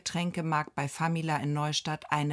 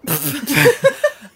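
loud laugh; 7 semitones higher, 13 dB louder than usual. Real, not acted. Recording starts, both burst out.

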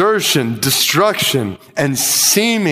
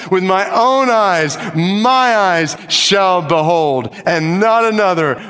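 much higher, ringing proclamation; fervent preaching climax; 7 semitones higher than usual. Real, not acted. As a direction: shout the hope to the church. Climax of the sermon.

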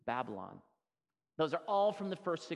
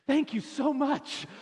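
high, loud, slow gushing gratitude; hammed-up thrilled student voice; overjoyed thanks; 11 semitones higher, 6 dB louder, slower than usual. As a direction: gush like a delighted kid. Student thanks for new name.

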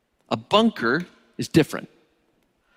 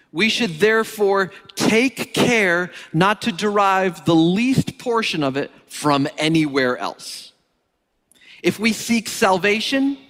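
higher, rising preacher's reading of a quote; fervent reading; 7 semitones higher than usual. Acted. Preacher reading Calvin to the congregation, fervent and higher. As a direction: read it like a proclamation, each line lifting.

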